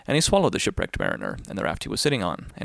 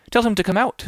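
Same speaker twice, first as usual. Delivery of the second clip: much higher, louder little fish; brisk fish advice; 11 semitones higher, 6 dB louder than usual. Acted. The little fish advises the whale, high and loud. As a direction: chirp it out high and quick.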